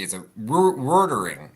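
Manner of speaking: ordinary talk, nothing marked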